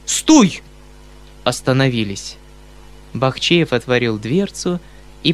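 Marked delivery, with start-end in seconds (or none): 0.0-0.6 high, loud shout to the coachman. Acted. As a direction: yell for the carriage to stop. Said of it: "закричал Бахчеев кучеру".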